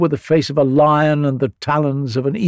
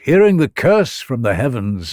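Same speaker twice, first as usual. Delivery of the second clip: ordinary delivery, nothing marked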